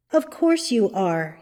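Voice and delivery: ordinary delivery, nothing marked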